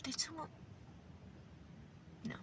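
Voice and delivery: hushed, startled question; soft frightened whisper; scared whisper. Real, not acted. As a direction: whisper it as if someone's in the room. Startled by a noise, mid-recording.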